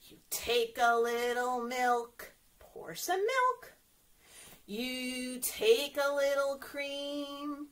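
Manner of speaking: slow, drawn-out instructions with actions; slow step-by-step demo. Shown as a demonstration. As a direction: stretch each step out so the kids can follow. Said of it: "I'm gonna get ready in shaking position".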